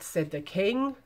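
ordinary delivery, nothing marked

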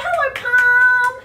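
high, loud drawn-out call; sing-song greeting. Pretend. Teacher greeting palms with children, sing-song call. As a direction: call it out, holding the last word.

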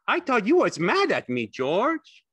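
very high, loud simple fellow; Lenny, childlike. A narrator's reading. Lenny asking if George is mad, high and childlike.

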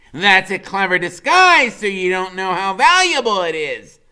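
high, loud, knowing; a boy explaining a secret; Freak's voice. A narrator's reading. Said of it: "he says".

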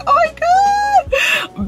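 high, slow, tearful shout of joy; crying shout. Retold or imitated. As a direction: scream it through happy tears. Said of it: "And I was like".

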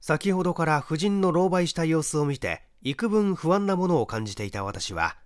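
ordinary delivery, nothing marked

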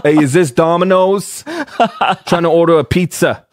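loud hammy New York caller; New York accent. Pretend. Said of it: "I'm stereotyping right now".

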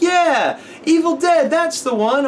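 put-on high, loud little boy confirming; excited kid voice. Kid confirms the movie title, in a high excited voice.

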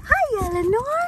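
very high, singsong greeting. Real, not acted. Greeting puppy and older dog, high singsong cooing. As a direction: coo each hello, gliding down from a high start. Greeting dogs in baby talk.

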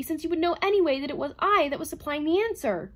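high, loud protest; crying out. A narrator's reading. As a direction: cry it out in agitation. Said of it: "cried Herbie".

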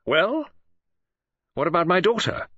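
high, eager and pressing; can't wait for the answer, push it out high; anxious father demanding news; impatient demand. A narrator's reading. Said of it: "hardly waited until he had come".

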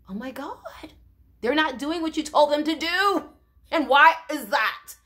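shocked. Real, not acted. Preacher telling the class about the defiance, in disbelief. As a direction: gasp at their defiance.

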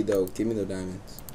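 ordinary talk

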